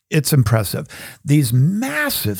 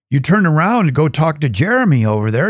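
ordinary talk, nothing marked

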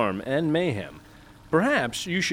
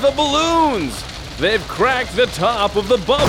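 much higher, louder alarmed yelling; hammed-up panicked shout; 9 semitones higher, 5 dB louder than usual. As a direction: call it out as the sky cracks. Son shouts at disaster.

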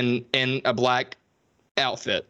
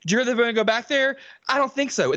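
high, fast prissy mimic; mock Jessica; 8 semitones higher, faster than usual. Mocking Jessica's repeated line, high and quick. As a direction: rattle off the line like a haughty heroine. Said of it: "Jessica goes".

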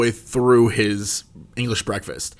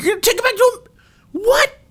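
incredulous; much faster than usual. Retold or imitated. Disbelief at taking pie home.

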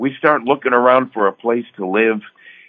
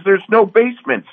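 worried wife; 12 semitones higher, 3 dB louder than usual. Retold or imitated. Wife voiced, complaining.

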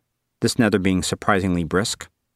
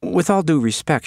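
put-on high girl's voice; 8 semitones higher than usual. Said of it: "she said".

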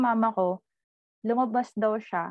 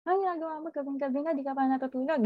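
a higher-pitched retold scolding, mother to her boy; mother catching her son awake late at night, lightly scolding; mock scolding mother; 6 semitones higher, much faster than usual. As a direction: scold him for being up so late. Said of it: "pinagalitan niya".

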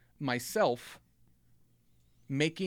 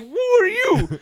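startled yell; 11 dB louder than usual. Retold or imitated. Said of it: "he's like".

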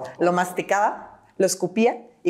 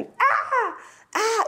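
eagle screams. Retold or imitated. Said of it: "y me gritaba".

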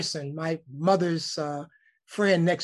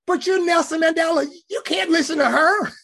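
high, loud, disbelieving protest; high-pitched outraged disbelief; exasperated plea; 11 semitones higher, 5 dB louder than usual. Retold or imitated. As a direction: plead with him as if he's obviously above this. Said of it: "I am getting ready to say".

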